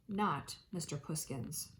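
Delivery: usual speaking voice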